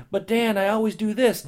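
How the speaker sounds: high, loud mock protest; whiny objecting listener. A preacher mocks a listener's excuse, in a high, loud pleading voice. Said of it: "You say".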